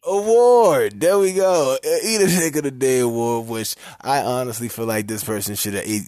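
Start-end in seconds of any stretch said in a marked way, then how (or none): 0.0-3.5 high, loud announcer burst; excited announcing. Real, not acted. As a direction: announce it with a flourish. Announcing the award name.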